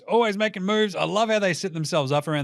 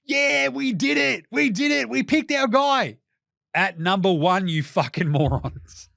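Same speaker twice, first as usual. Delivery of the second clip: gloating Atlanta; 7 semitones higher, 3 dB louder, slower than usual. Retold or imitated. Atlanta gloating over pick.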